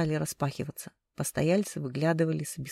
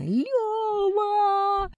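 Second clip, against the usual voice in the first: very high, long-held wail of a name; drawn-out high scream; yelling; 4 dB louder than usual. A narrator's reading. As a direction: shriek for him down the corridor. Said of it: "заорала Инна".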